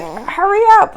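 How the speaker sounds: high, loud hurry up; impatient yelp in labor; urgent plea. Retold or imitated. Impatient in slow-filling tub.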